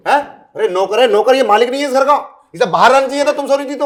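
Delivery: husband scolding his wife over the servant, loud and angry; angry rant. Acted. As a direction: lay into her about the servant. Husband rants at wife about servant.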